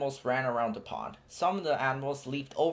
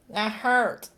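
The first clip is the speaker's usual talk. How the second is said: hint of hurt baby elephant; 10 semitones higher, 4 dB louder than usual. A narrator's reading. Bombo hurt.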